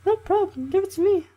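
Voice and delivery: put-on very high girl voice; Slavia voice. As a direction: squeal it like a bright camp girl. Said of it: "saw Slavia".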